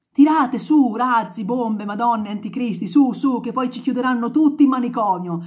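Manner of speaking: loud, high ranting at the rockets; furious shout. Acted. Redenta yelling out the window at the rocket launchers. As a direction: hurl curses at the sky.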